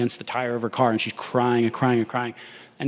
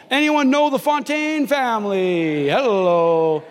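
mock megaphone call; 7 semitones higher, 3 dB louder, slower than usual. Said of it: "I could have had a megaphone".

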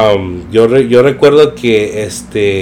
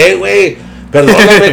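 retold apologetic friend; 7 semitones higher than usual. Friend cancelling on him, sheepish higher apology. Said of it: "y decía".

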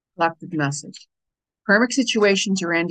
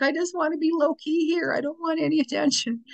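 much higher, playful self-quote; own inner thought; 9 semitones higher than usual. Retold or imitated. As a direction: voice her embarrassed inner thought, lightly. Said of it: "You know, I'm like".

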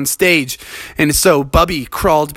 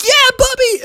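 very high tiny critter voice cheering; squeaky little animals. Acted. Little animals cheering on Bubby, very high squeak.

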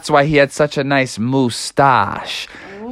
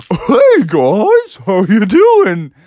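higher bright otter greeting, slowed at the end; cheery otter voice; 4 semitones higher, 4 dB louder, much slower than usual. Acted. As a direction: greet everyone as a friendly little otter.

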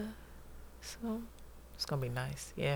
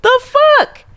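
high, loud laughing exclamation; can't believe the luck; giddy disbelief; 15 dB louder than usual. Retold or imitated. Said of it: "I'm like".

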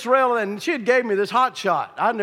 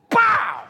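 sudden high "pow" of impact; imitated punch; 13 semitones higher, 3 dB louder than usual. Man hitting the bull on the nose, imitated punch. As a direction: explode on one high syllable.

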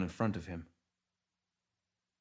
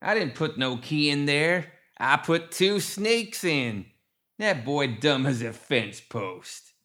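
high, loud, slow backwoods crowing; Ma Tuckett; 6 semitones higher, 5 dB louder, slower than usual. A narrator's reading. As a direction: drawl it scornfully as a gremlin matriarch. Ma Tuckett gloats in gremlin voice.

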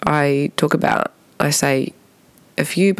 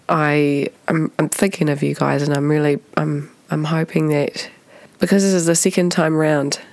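ordinary talk, nothing marked

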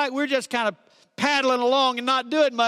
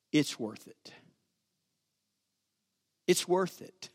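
tender hush; 7 semitones lower, 8 dB quieter than usual. Acted. Voicing Jesus on throne tenderly.

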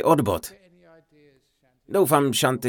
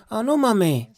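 put-on innocent little boy; 8 semitones higher than usual. Boy answers his mother.

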